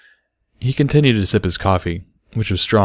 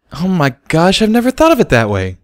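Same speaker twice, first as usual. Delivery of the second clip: much higher, amazed; high astonished gasp of words; surprised; 10 semitones higher, 4 dB louder than usual. Acted. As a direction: struck by the idea. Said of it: "looking surprised".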